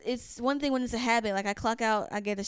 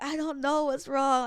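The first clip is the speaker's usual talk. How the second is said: slow, higher helpless protest; mock-distraught, pitched up answer; retold bewildered distress; 4 semitones higher, 4 dB louder than usual. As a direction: act out upset confusion, drawn out. Retold tearful answer to mom.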